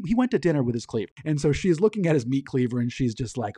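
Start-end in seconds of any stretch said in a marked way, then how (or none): none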